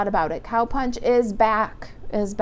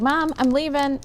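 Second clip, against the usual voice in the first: lightly voiced daughter calling, higher pitch; imitated daughter; 7 semitones higher than usual. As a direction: call out to mom in a lifted voice.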